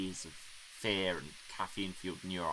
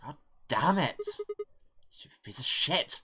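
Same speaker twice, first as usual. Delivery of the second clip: loud, high outburst of anger; frustrated scream; 8 semitones higher, 6 dB louder than usual. Acted. Stranded driver screams at his dead car, furious yell. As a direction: yell it at the stalled car, higher and louder. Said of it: "I screamed and pounded on the steering wheel".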